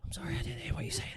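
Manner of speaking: quick, barely audible whisper; hushed joke whisper; mock whisper. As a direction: whisper fast as a teasing quiet talker. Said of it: "I'm just gonna whisper".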